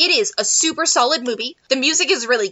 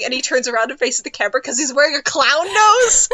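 ordinary delivery

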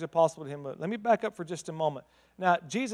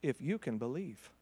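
soft, lowered voice, drawn down; quiet and low; 5 semitones lower, 12 dB quieter than usual. Acted. Jesus answering the father, quiet and low. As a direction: let it fall quiet and low. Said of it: "this is Jesus' response".

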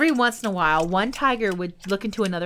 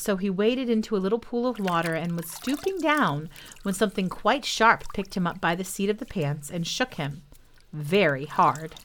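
ordinary delivery, nothing marked

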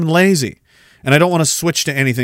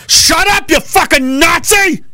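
mock yell at son; 11 semitones higher than usual. Father yelling at his son, hammed-up shout. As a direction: snap at the kid in a hammed-up shout.

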